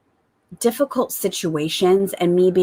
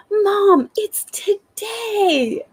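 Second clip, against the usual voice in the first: put-on very high, very slow child squeal; excited little girl; 13 semitones higher, much slower than usual. Little girl waking mom at dawn, thrilled. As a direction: imitate a toddler bursting with wonder. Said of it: "the light in her eyes when she's like".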